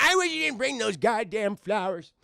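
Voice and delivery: put-on loud, high-rising old man's complaint; cantankerous acted old man; grumpy grandpa. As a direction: grouse in a hammed-up grandpa voice. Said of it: "And Grandpa's like".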